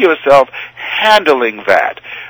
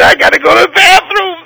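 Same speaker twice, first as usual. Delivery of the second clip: high, pressed, half-laughing panic; panicky urgency; 4 dB louder than usual. Retold or imitated. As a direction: blurt it like you can't hold it, much higher. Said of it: "I get excited".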